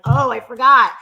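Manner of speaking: loud mock realization; sarcastic. Woman retelling her replies in online fights, sarcastic. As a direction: exclaim it with mock apology.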